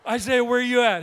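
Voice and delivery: high loud urgent demand; loud high urgent phone call to Isaiah. Retold or imitated. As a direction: call him in a panic. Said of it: "She, she said".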